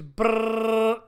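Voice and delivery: rolled lips drum roll sound. Pretend. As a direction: buzz out a mock drum roll. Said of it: "That's my drum roll".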